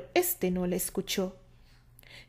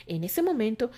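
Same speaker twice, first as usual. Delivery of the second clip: usual speaking voice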